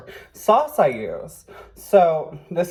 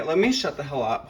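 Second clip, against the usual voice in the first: usual speaking voice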